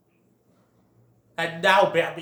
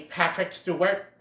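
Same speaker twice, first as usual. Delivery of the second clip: ordinary delivery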